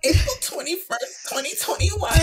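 excited. Retold or imitated. Reliving her wedding date emotionally.

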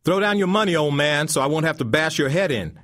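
high, loud menace; threatening thug. A narrator's reading. Thug demanding the old man's money, loud threat. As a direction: threaten him to hand over the money.

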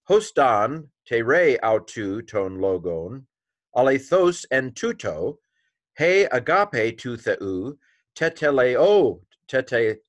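slow, deliberate reading, word by word; careful Greek read-through. Shown as a demonstration. Teacher tries to read it all for learners, slow and deliberate. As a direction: sound out the Greek slowly and carefully. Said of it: "let me try to read it all".

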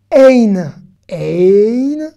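Ein called out loud; modeled word. Shown as a demonstration. Teacher modeling a Hebrew word for learners, loud. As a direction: say Ein clearly and firmly. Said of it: "teacher models word".

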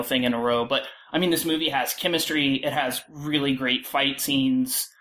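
ordinary delivery

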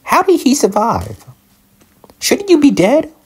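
very high, loud disbelieving squeal; shocked squeal. Real, not acted. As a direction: squeal in shock that it's still alive. Shocked skeleton survived.

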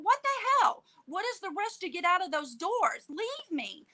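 high-pitched indignant protest; exasperated outburst. Retold or imitated. Wheelchair user protesting the rush to the doors, exasperated. Retelling outrage at being rushed out.

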